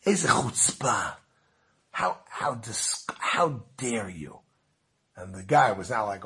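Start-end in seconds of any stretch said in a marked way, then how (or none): 0.0-4.4 slow, halting mock indignation; indignant. Visitor rebuking the boss for chutzpah, slowly indignant. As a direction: act appalled, dragging out each word.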